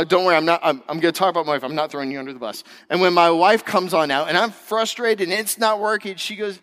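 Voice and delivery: ordinary talk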